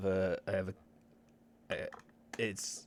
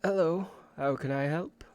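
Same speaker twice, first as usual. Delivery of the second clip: a lightly voiced, higher middle-aged woman; polite scholar greeting, lightly voiced; 7 semitones higher, 4 dB louder than usual. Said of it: "Chinese woman, middle-aged".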